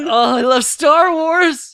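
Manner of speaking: high loud slow gush; gushing fan. Acted. A fan gushing about Star Wars. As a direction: gush over it like a giddy fan.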